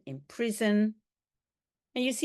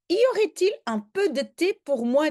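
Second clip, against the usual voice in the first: quick, high little dog voice; a small dog begging for tea; voicing Milou; 7 semitones higher, 4 dB louder, faster than usual. Teacher voices Milou the dog begging for tea.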